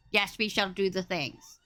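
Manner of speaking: ordinary talk, nothing marked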